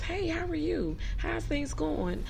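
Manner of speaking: high, drawn-out polite hello; lifted, sociable demo greeting; friendly greeting. Shown as a demonstration. Models a polite phone greeting.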